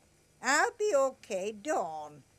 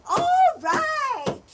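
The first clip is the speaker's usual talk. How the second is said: high, loud yell at the door; loud shout; 13 semitones higher, 7 dB louder than usual. Acted. Landlady yelling at someone pounding on the door, loud shout. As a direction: bellow it to be heard.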